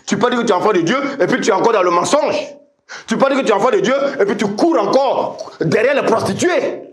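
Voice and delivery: high, rapid, climbing exhortation; insistent preaching. Real, not acted. Preacher warning believers against living in sin, insistent preaching.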